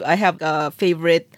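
normal talking voice

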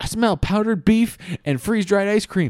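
put-on squeaky complaining boy voice; whiny drummer boy. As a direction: whine it out like a miserable kid soldier. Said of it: "he's like".

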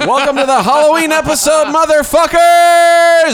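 high, loud gleeful yell; shouting. Pretend. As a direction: scream the welcome. Shouted Halloween intro.

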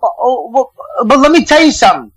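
high, fired-up rant with pitch jumping well up; defending Boston to the hosts, fired up and high. Real, not acted. To the hosts about his hometown, a fired-up high rant.

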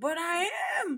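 put-on high, loud crying child protest; mock bawling child; whiny shout. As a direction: wail it like a hurt kid. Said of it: "I'm like".

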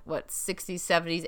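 ordinary talk

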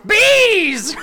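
high loud "Bees!"; shouted soundbite. Acted. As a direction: yell it like a goofy sound effect. Reading a silly soundbite.

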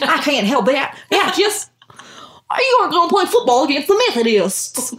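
high, loud mock Southern kid accent; thick Southern accent. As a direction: overdo a child actor's Southern accent. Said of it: "those accents".

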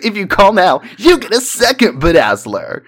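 fast, excited infomercial pitch; home shopping host. Retold or imitated. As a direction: sell it like a late-night TV ad. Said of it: "and it's, like".

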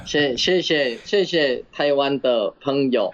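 put-on slow Mandarin in a Japanese accent. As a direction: thank them in a Japanese accent. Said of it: "这个日本腔也是OK".

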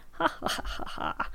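high, whispery laugh; odd put-on laugh. Host promising lots of detail, odd put-on laugh. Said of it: "What was that laugh, [name]?".

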